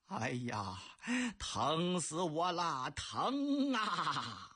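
slow groaning cry of a headache; in pain. Acted. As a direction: moan it out as the pain hits. Emperor's head pains. (杨广疼得直哼哼)

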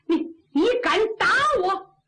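high, stuttered outrage; affronted anger. Acted. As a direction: bristle at being slapped. Slapped officer confronts the girl. (又惊又怒地质问)

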